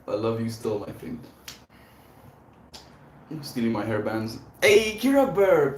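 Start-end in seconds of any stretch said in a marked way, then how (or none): 4.6-5.8 loud, high, drawn-out hail; excited greeting. Real, not acted. Streamer greeting a friend joining chat, loud and excited. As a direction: call out a big high hello.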